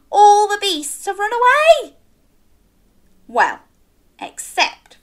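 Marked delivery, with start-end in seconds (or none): 0.1-1.9 high gasping exclamation; gasp, then marvel; surprised gasp. A narrator's reading.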